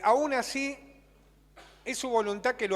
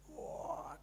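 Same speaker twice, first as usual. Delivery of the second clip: stunned gasp; 7 semitones higher, 14 dB quieter than usual. Retold or imitated. Stunned by the drunk's message.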